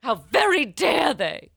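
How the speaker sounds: slow, loud, hammed-up indignation; hammed-up "how dare they" indignation; mock outrage. Mock outrage as the offended guest.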